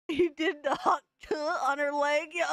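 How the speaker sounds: words squeezed out through crying laughter; can barely get it out for laughing; laughing to tears. Real, not acted. Streamer losing it laughing at the clip, crying with laughter. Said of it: "I'm fucking crying".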